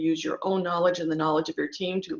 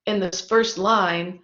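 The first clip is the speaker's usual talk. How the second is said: ordinary talk, nothing marked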